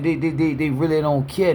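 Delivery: ordinary talk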